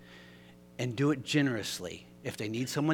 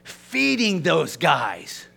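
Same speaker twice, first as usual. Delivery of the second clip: accusing; 4 semitones higher, 9 dB louder, much slower than usual. Retold or imitated. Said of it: "He said".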